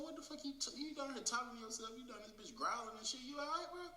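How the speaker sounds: high, squeaky-pitched disbelief at ordinary volume; retold incredulous questioning. Teller to friend talking to himself, retold high incredulous questioning. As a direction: replay your baffled questioning way up high.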